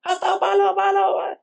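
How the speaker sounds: high, frantic coaching, climbing in pitch; imitated mother cheering. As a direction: urge on your blindfolded child, building to a squeal. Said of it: "pag narinig yung boses niya". (nanay na sabik na sumisigaw ng direksyon, pataas nang pataas)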